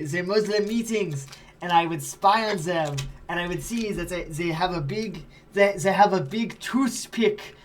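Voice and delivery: a light, half-German put-on accent; a hint of a German accent, half-hearted; almost German accent. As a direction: spin the tale in a slipping German-ish accent. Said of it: "That was almost German".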